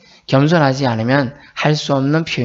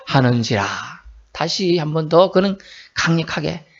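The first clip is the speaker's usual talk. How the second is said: usual speaking voice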